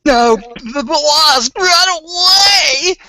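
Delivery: high, slow crying wail; hammed-up terror; mock panic. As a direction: wail like you're fleeing. Joking panic about wasps.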